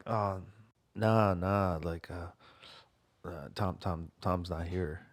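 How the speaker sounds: quiet, low, slowed solemn fake; hushed grave tone. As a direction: murmur it like bad news. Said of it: "And I'm like".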